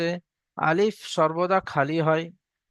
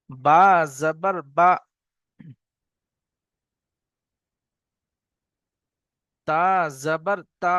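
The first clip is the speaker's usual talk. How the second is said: slow, clear call-out of each letter; recitation drill; slower than usual. Acted. Teacher leads students in a slow letter drill. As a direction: chant the letters for the class to repeat.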